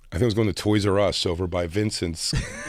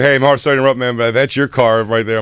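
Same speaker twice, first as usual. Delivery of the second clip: mock interruption; 3 semitones higher, 6 dB louder than usual. Doorman interrupting the show.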